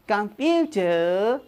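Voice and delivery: कंप्यूटर drawn out slowly, each syllable clear as kam-pyu-tar; careful pronunciation. Shown as a demonstration. Teacher slowly models कंप्यूटर for students to repeat. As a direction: spell out कंप्यूटर slowly for the class. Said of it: "बोलो तो, कंप्यूटर".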